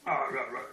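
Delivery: loud mock "rah rah" babble; noisy nonsense chatter of a nagging inner voice; gibberish grumbling. Little block saying its thing on cue, loud grumbling gibberish.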